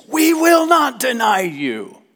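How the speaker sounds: high, slow, loud shout of will not; emphatic group shout. Retold or imitated. Disciples all vowing to Jesus, loud and slow. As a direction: chant it like a crowd all swearing loyalty. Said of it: "into making emphatic statements".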